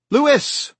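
high, loud call for her husband; urgent call. Acted. Rachel calling Louis in alarm, loud and high.

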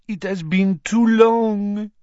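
put-on slow, throaty French-accented woman. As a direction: purr it slowly in a French accent. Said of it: "she said in a throaty voice".

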